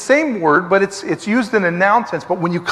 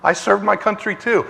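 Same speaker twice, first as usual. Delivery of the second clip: ordinary talk, nothing marked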